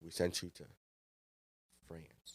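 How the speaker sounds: loom over them with long, ominous pauses; imagined stern boss. Retold or imitated. Imagined head chef questioning his cook, towering, quiet menace. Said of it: "towering over me".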